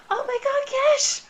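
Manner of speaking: high excited cry of his name; delighted squeal. Acted. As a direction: squeal with joy as you hug him. Nina joyfully greets uncle.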